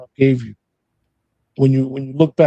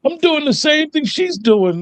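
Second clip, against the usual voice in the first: imitating; 12 semitones higher than usual. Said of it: "am I acting like my mother".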